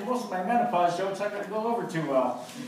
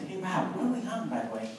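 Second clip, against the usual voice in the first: hushed; 8 dB quieter, faster than usual. Retold or imitated. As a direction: keep it down in the woods. Whispering while hunting.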